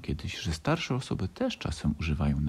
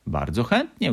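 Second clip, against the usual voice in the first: mildly glad, a little higher; pleased; 4 semitones higher, 4 dB louder than usual. A narrator's reading. Little fox agreeing to go along, mildly pleased. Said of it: "ucieszył się Franio".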